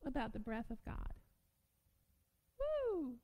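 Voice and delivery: ordinary delivery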